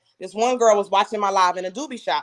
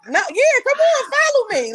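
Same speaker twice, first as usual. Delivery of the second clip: high-pitched, eager invitation; eager welcome; 4 dB louder than usual. Retold or imitated. Woman replying to shy would-be followers, high eager welcome. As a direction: squeal it like an open-armed welcome.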